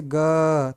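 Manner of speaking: "ग" held long on its "अ" vowel; drawn-out letter sound. Shown as a demonstration. Teacher demonstrating the inherent vowel, "ग" drawn out. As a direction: stretch "ग" so the vowel rings out. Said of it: "जब भी इसका उच्चारण करेंगे".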